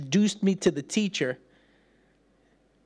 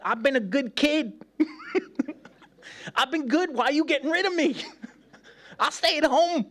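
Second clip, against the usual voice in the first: put-on high, tearful kid begging through laughter; a scared little boy pleading not to go; pleading four-year-old; 11 semitones higher than usual. Preacher replays his child self begging his mother. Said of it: "I was like".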